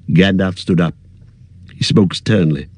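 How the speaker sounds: ordinary delivery, nothing marked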